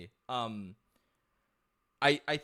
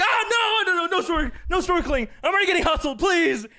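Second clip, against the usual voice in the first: panicked mock pleading; 7 dB louder than usual. Retelling escape from zombie-like hawkers.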